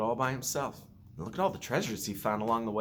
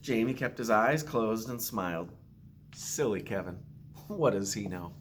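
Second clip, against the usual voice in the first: put-on gentle storytelling read to children; story narration. Principal reading a picture book to students, gentle storytelling.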